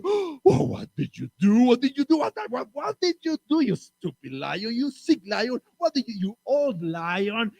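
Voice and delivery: high, slow, horrified scolding; shocked animals. Acted. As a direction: accuse the lion in disbelief.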